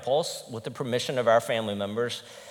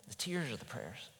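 hushed repeat; 15 dB quieter than usual. Real, not acted. Repeated softer.

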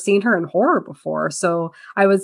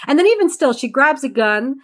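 ordinary talk, nothing marked

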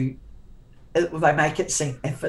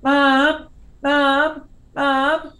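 put-on kids calling out; 10 semitones higher, 5 dB louder, much slower than usual. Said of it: "they'd go around the house going".

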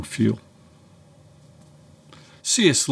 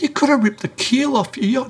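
much higher, alarmed scolding; 13 semitones higher than usual. Retold or imitated. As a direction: rebuke him in shocked disbelief. Alarm at possible keel damage.

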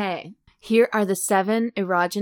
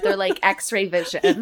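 ordinary talk

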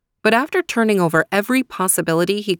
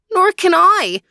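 much higher, louder despairing cry; pitched-up louder wail of despair; cried in despair; 13 semitones higher, 6 dB louder than usual. Acted. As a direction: cry out hopelessly. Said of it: "cried Faraz in despair".

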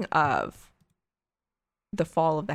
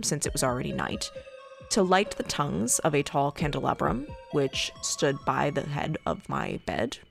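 hushed creepy legend telling; 2 semitones lower, 3 dB quieter than usual. Acted. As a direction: read it like a ghost story by candlelight. Reads Poe tale over music.

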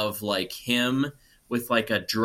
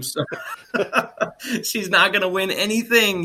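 laughing through the words, higher and louder; giggling; 9 semitones higher, 4 dB louder than usual. Real, not acted. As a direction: crack up while delivering the verdict. Laughing at the bad movie.

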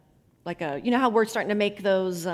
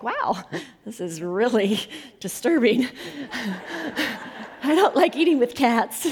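amused mock disgust, breaking into laughs; laughing; 4 semitones higher than usual. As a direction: giggle between the words. Laughing over retold cat dinner.

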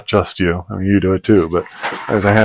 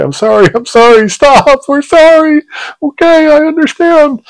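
high-pitched, loud panicked pleading; frantic apology; 6 dB louder than usual. Retold or imitated. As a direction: mimic a frantic passenger apologizing. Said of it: "trying hard to say".

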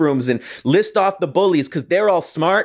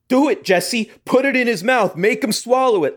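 higher, egging-on mock yell; retold shouting; 7 semitones higher than usual. Teammates egging Jesse on, mild mock yell. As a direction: push him on like a goading teammate. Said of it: "they were shouting".

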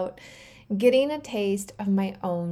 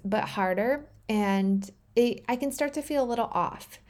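ordinary delivery

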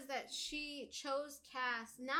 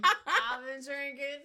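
slow mock repeat; 2 semitones higher, 8 dB louder, slower than usual.

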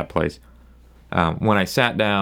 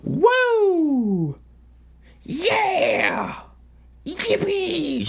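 high gleeful whoops; drunk whooping; much slower than usual. Retold or imitated. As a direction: cheer like the song just came on. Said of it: "he was like".

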